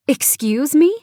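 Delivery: high, loud questioning jump; amused disbelief. A narrator's reading. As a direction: jump up in pitch, mock-incredulous. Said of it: "I ask with a laugh".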